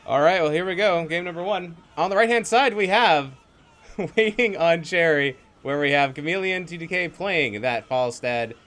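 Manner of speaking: loud, excited esports casting; hyped caster. Acted. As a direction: announce the teams with energy. Caster introduces teams loudly.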